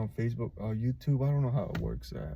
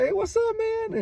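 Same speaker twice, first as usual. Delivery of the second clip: much higher, louder retold call-out; upbeat greeting; 6 dB louder than usual. Retelling his friend picking up a video call, upbeat greeting. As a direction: imitate a friend's high-pitched, louder hello.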